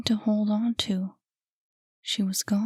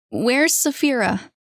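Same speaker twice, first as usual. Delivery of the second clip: asked again, louder and higher; raised voice; 4 semitones higher, 6 dB louder than usual. Acted. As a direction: push the question harder, insisting. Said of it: "He raised his voice".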